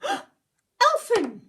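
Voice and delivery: high, loud dismayed cry; storyteller shocked; horrified gasp. A narrator's reading. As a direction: gasp out the name in horror. Horrified reveal.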